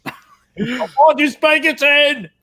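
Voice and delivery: loud, high, drawn-out yell; hammed-up cry to a lost love; longing cry. Character calls to Spankerton before he drives off, hammed-up longing yell.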